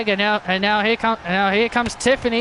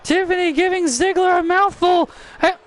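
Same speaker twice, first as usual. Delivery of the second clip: excited play-by-play; 8 semitones higher than usual. Real, not acted. Commentator calling Ziggler's attack on Waller, excited shout. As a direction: yell the brawl as it breaks out. Live post-match brawl call.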